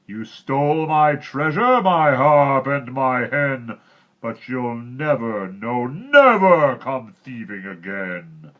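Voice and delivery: loud, slow, high furious roar; slow booming giant threat. A narrator's reading. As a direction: roar down at the fleeing thief. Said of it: "with the giant roaring behind him".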